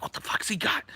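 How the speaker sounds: soft unvoiced whisper; hushed wary whisper; nervous whisper. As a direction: whisper it to yourself, uneasy. Kid wondering what's in hand.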